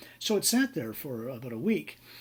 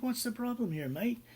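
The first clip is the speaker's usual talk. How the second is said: put-on high-pitched Australian accent; mock-Australian voice, pitched well up; Australian fellow; 10 semitones higher than usual. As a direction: imitate an Aussie asking breezily. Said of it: "the Australian fellow".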